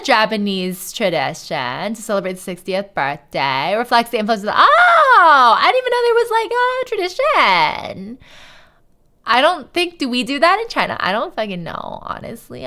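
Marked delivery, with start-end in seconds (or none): 4.5-8.2 high-pitched oh shouted, then high amazed words; astonished at learning something new; surprised discovery. Real, not acted. Reading about sixtieth birthdays, shouts out in high surprise.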